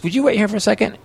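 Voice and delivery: clerk's polite request. Retold or imitated. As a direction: mimic a polite store lady. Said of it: "And she goes".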